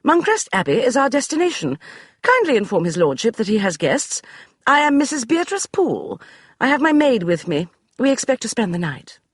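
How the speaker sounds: icy well-bred woman; cool crisp lady. Acted. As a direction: brook no argument. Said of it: "in a cool, crisp voice that brooked no argument".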